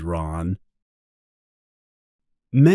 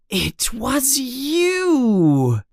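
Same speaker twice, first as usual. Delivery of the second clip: slow, drawn-out realization; slowly; 13 semitones higher, much slower than usual. Acted. Harry realizes Dobby's trick, speaking slowly. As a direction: let the truth dawn on you word by word. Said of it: "he says slowly".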